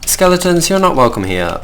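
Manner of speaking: scolding game monsters. Real, not acted. Player to unwelcome skeletons in the game, scolding. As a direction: scold the intruders, voice jumping up then falling.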